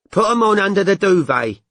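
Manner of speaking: firm instruction to her boyfriend, lightly voiced; instructing. A narrator's reading. Mandy tells her boyfriend to dress, bossy instruction. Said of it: "she instructed him".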